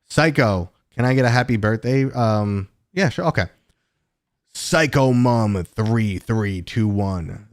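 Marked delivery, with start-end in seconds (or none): 4.5-7.5 loud, drawn-out Space Marine shout-out. Pretend. Streamer giving a birthday shout-out on request, as a Space Marine. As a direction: boom it slowly like a Space Marine. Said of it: "kind of Space Marine voice for that".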